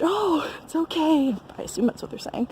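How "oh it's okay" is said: imitated reassurance. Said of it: "tell me in French".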